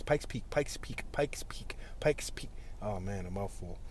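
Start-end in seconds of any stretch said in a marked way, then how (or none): 0.0-2.5 tongue twister drill. Shown as a demonstration. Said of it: "Try to say that, like, six times".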